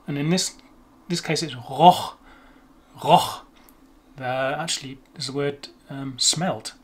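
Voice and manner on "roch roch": "roch" with German R and throaty ch, hit firmly; German R and hard ch. Shown as a demonstration. Teacher showing a difficult word, German R and hard ch. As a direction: say "roch" with throaty R, then scrape the final ch. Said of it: "R sound and the C H sound".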